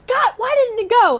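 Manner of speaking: high, loud mock shout; exasperated director. Director yelling at actress after failed nosebleed, exasperated shout. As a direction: yell it like a frustrated director, half laughing.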